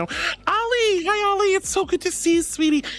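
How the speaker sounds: high-pitched, gushing hello; delighted high greeting for someone just arrived; excited greeting. Real, not acted. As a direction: squeal a sweet welcome to a friend. Greeting a friend joining the stream.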